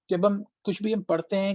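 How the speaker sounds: normal talking voice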